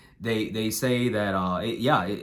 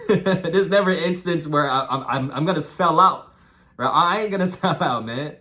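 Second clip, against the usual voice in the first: laughing; 6 semitones higher, 6 dB louder than usual. Real, not acted. Laughing while insisting he won't sell out.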